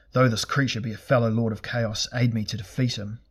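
plain reading voice

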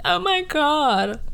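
mock teary, high, slow "oh my god"; a hint of overwhelmed tears; emotional gush. Imitating herself tearing up at a TV episode, high and slow.

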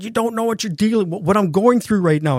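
high-pitched, heated protest; re-enacted argument, pitched well up; acted angry outburst. Acted roadside quarrel, pleading and angry.

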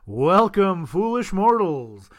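booming haunted-house host; spooky host greeting. Acted. Spooky host greeting after ghost intro.

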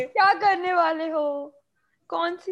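high, loud, drawn-out aunty wail; nosy neighbor aunty. Pretend. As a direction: quiz the kid like a nosy auntie. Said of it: "पड़ोस वाली aunty की आवाज में".

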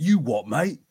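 put-on light Cockney accent, cheeky challenge; a touch of a tough Cockney Londoner; Cockney Knuckles. Knuckles imagined as a Cockney, answering back in Cockney. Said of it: "Knuckles were like".

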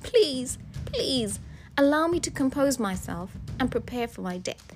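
soft, high-pitched begging; hushed, imploring wife; pleading. A narrator's reading. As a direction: beg for your life, gently and desperately. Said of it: "She pleaded for her life".

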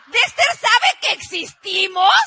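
slow, loud, high cry of disbelief; stunned disbelief. Retold or imitated. Wife unable to believe the famous man knows them, loud slow disbelief. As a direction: drag out the question, amazed.

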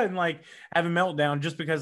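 ordinary talk, nothing marked

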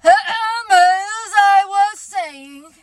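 slow, loud, stern throat-clearing. Acted. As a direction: call the room to order, sternly. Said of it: "said Eeyore loudly and sternly".

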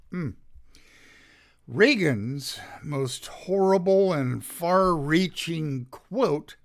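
normal talking voice

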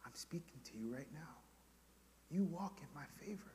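very soft, intimate near-whisper; God's hushed voice. Acted. God speaking to the believer right now, hushed. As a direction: say it barely above a whisper.

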